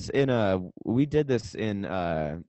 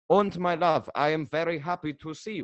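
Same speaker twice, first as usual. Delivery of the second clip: put-on higher-pitched hammy German-Russian accent; German-sounding Russian accent; 5 semitones higher, 4 dB quieter than usual. Russian actor wooing his love, muddled German-Russian accent. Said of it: "That's a German accent".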